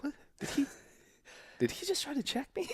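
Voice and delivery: high-pitched puzzled reaction; mock disbelief. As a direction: act out someone doubting they were just insulted. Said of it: "They go, like".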